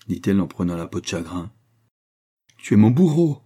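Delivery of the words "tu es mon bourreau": loud, high accusing cry; horrified cry. A narrator's reading. Young man stares in horror at the talisman, anguished shout. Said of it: "cria le jeune homme".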